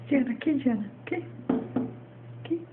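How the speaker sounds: ordinary talk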